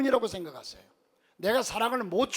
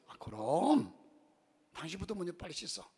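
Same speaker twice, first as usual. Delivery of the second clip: a softer, tender Seoul husband; tender reply; 7 dB quieter than usual. Retold or imitated. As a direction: reassure the wife kindly. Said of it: "자상하게 대답합니다". (자상하고 부드러운 남편 대답)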